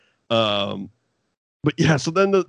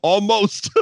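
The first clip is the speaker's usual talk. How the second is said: laughing quote; 10 semitones higher, slower than usual. Retold or imitated. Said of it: "he goes".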